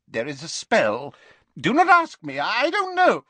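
agitated professor backing away from the question; flustered professor. Acted. Professor refuses to tell pupil the spell, flustered. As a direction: jump to a high, loud protest.

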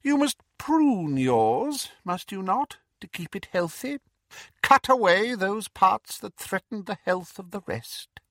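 high, cold, silky menace; sinister dark lord; Voldemort voice. Acted. Voldemort advising Bellatrix.

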